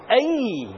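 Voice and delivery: short pained "Ay". Shown as a demonstration. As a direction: yelp it like a hit finger. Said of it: "some cultures will say".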